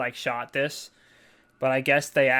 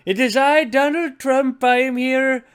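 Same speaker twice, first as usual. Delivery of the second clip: high, loud put-on Trump voice; Donald Trump impression; 9 semitones higher, 6 dB louder than usual. Mocking Jennifer to the hosts as Trump, high and loud. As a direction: ham up a Trump bit. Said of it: "this is more low effort than every other Donald Trump bit".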